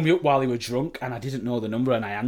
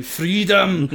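raised, half-joking battle cry; mock scream; 6 semitones higher, 5 dB louder than usual. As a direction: call it out like a hammed-up Scottish warrior. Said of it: "I was screaming".

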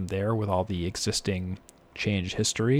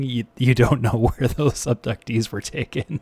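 louder, laughing through the words; 3 semitones higher, 5 dB louder than usual. Real, not acted. Telling co-host the alien abduction analogy, laughing. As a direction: crack up at your own joke.

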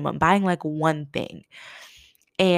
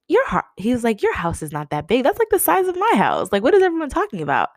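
mocking rich boy; 7 semitones higher than usual. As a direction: mimic a bratty rich kid sneering. Rich boy mocking house size.

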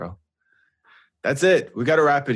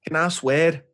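Liverpool impression; 6 semitones higher, slower than usual. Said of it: "Proper Liverpool guy".

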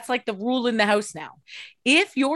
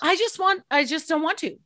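mock whine; 8 semitones higher than usual. Whining about not wanting to.

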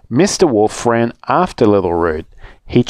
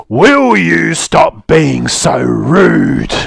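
slow, louder angry wolf roar; 5 semitones higher, 4 dB louder, much slower than usual. Acted. Mister Wolf losing his temper at Little Rude, roaring slowly. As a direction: roar it at her, drawn out. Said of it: "and roared".

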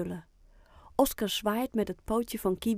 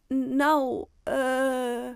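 drawn-out, higher-pitched hesitation; stammering; 5 semitones higher, 5 dB louder than usual. A narrator's reading. Boy caught with his secret, stammering hesitantly. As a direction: stall for words, holding each sound. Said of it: "stamelt Oscar".

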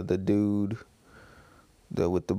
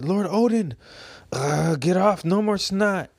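teary retainers; 8 semitones higher than usual. Acted. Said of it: "the last page says".

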